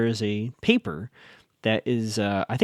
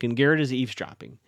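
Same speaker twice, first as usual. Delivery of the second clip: ordinary talk, nothing marked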